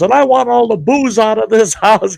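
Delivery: high, loud furious order; imitating a furious friend; angry demand. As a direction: bark it out in a rage.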